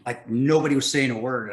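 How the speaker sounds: normal talking voice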